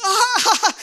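very loud high shriek through laughter; laughing scream. Retold or imitated. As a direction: shriek with relieved joy. Dolphin relief, joy and fear.

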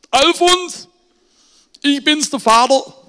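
viel höher und lauter, rufend ins Telefon; alter Vater am Telefon. Retold or imitated. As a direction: ein alter Mann, der laut in den Hörer ruft. Alter Vater ruft Sohn an. (alter Vater ruft laut seinen Sohn Alfons an)

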